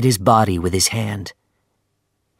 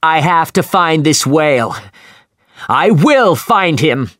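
shouting; 8 semitones higher, 6 dB louder than usual. A narrator's reading. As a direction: roar your vow at the crew. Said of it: "shouted Captain Ahab".

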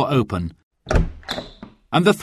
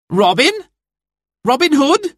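sharp high startled cry; angry cry; 4 dB louder than usual. Acted. Anne startled by Robin at her door, angry cry. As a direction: bristle at his name. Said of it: "She sounded angry".

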